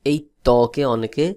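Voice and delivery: ordinary talk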